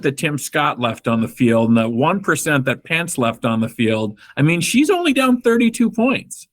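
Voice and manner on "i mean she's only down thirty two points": deadpan sarcasm pitched up high; sarcastic. Real, not acted. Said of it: "That was sarcasm".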